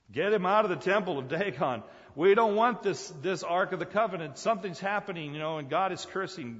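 loud, high-pitched acted panic; alarmed demand. Philistines want the ark gone, alarmed demand. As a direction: urgently push it away, easing off at the end.

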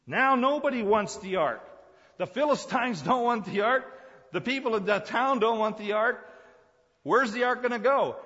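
very high, loud oratory; forceful preaching. Real, not acted. Preacher says nobody will take the ark, forceful preaching. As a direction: cry it out in rising, punchy phrases.

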